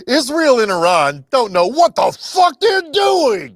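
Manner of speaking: retold outburst. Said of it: "Trump says".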